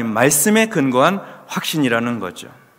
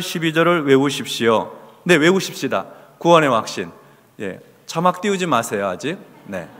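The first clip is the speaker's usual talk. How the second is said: usual speaking voice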